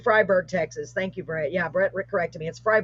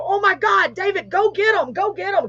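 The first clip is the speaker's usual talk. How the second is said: retold panic, pitched way up; high, urgent mock panic; freaking out; 11 semitones higher, 3 dB louder than usual. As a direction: re-enact a frantic plea for help in a high voice. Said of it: "'cause I'm freaking out".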